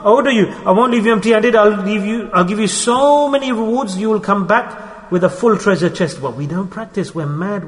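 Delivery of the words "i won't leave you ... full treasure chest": ordinary talk, nothing marked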